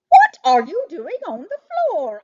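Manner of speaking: high, loud surprised question. A narrator's reading. Harold finds Chester knocked to the floor, loud surprised question. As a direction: blurt it out in astonishment.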